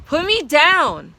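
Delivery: high, loud slow scream. A narrator's reading. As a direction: scream in terror at the horse. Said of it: "I screamed".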